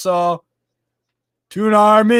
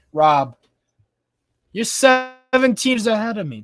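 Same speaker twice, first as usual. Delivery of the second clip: normal talking voice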